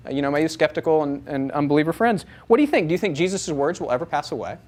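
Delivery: ordinary delivery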